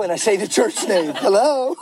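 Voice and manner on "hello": high put-on phone hello; faking fine. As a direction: answer brightly as if nothing's wrong. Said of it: "Like everything's fine".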